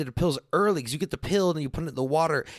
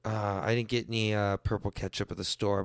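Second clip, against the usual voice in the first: tired mom; 8 semitones lower, 5 dB quieter than usual. Retold or imitated. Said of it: "And she'd be like".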